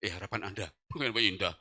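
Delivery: low, soft, halting words; weak sick voice. Retold or imitated. Sick husband asks wife for hospital, weak low voice. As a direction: talk with little breath left. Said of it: "Saya ngomong gini".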